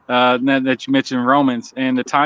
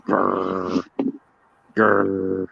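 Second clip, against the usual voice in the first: playful growl; 6 semitones lower, 4 dB quieter than usual. Pretend. Growling at the dog.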